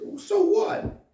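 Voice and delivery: scoffing. Retold or imitated. Rich man scoffs at the boy, high and loud. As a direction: toss off a dismissive scoff.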